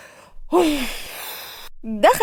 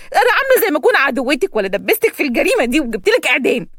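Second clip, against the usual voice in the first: usual speaking voice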